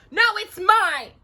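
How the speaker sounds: very high, loud shriek. A narrator's reading. Sisters fight over the letter, shrieking. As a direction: screech it possessively. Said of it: "Drusilla shrieked".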